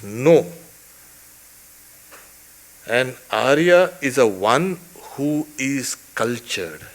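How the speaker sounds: ordinary talk, nothing marked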